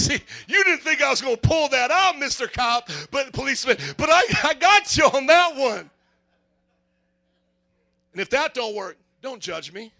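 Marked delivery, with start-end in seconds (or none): 0.1-5.9 gloating. Retold or imitated. Gloating at the cop.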